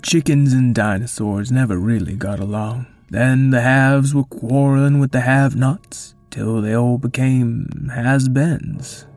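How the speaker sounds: put-on a dry, rueful old creature; wry Triceratops. Triceratops explains.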